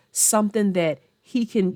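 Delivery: usual speaking voice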